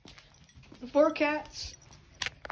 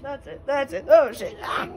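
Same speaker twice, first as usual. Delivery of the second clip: excited; 9 dB louder than usual. Real, not acted. Tense moment, excited shout.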